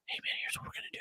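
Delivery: confidential, voiceless courtroom whisper; hushed whisper. Acted. Lawyer whispering to his client in the jury box, hushed.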